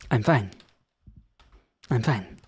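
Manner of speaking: mock-composed reassurance; forced calm. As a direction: pretend everything is fine. Pretending calm after outburst.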